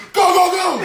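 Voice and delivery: high, urgent getaway yell; mock frantic shout. Fugitive who jumped on orders driver, mock frantic. Acted fugitive jumping on, retold.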